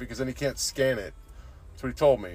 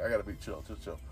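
normal talking voice

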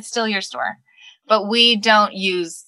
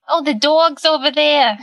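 higher, louder Long Island imitation of kids; mimicking the nieces' Long Island accent; 7 semitones higher, 5 dB louder than usual. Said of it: "they're like".

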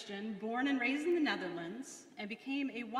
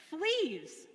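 sudden high, loud startled cry; cried out; 8 semitones higher, 7 dB louder than usual. A narrator's reading. Corrie discovers fleas in the straw, startled loud cry. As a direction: yelp it out in disgust. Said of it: "I cried".

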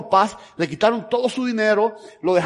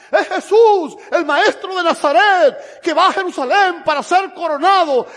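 high, loud excited crowd cry, retold; crowd shouting; 7 semitones higher, 4 dB louder than usual. Preacher voicing the crowd announcing Jesus, loud shout. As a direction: call out the news as the crowd. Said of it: "la multitud seguramente iba gritando".

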